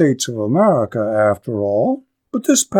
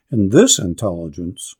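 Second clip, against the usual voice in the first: plain reading voice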